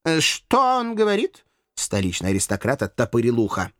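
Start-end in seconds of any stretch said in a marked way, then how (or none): 0.0-1.4 high, slow questioning voice; affected high-pitched query; puzzled aristocrat. A narrator's reading. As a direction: ask as if hard of hearing. Aristocrat pretends not to hear.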